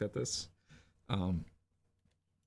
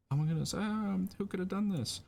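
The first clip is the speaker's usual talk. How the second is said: shocked teachers; 13 semitones higher than usual. Acted. Teachers discovering the empty cookie box, high and mock-horrified. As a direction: gasp it out like a scandalized teacher.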